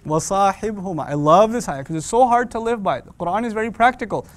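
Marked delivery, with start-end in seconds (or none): none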